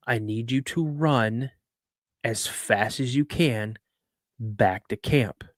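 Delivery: slow, deliberate, measured phrases; grave instruction. Retold or imitated. Dad tells son to run back to camp, slow and grave. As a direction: spell out the order slowly, phrase by phrase.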